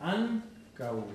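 Ancau said as two long beats, an-cau; two syllables. Shown as a demonstration. Teacher showing students the two syllables of Ancau, careful demonstration. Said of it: "Tiene dos sílabas".

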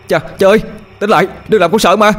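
high, loud frightened begging; panicked pleading. Acted. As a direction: plead frantically with a father to come round. Son pleads with injured father.

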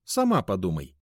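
irritable brush-off; snappish. A narrator's reading. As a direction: throw it back at the asker. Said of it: "огрызнулся он". (раздражённо огрызнуться)

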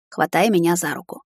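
plain reading voice

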